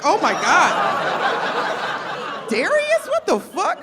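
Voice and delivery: high, loud shocked squeal at a student; high scandalized cry, half laughing. Retold or imitated. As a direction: shriek it in disbelief at Darius. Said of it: "I was like".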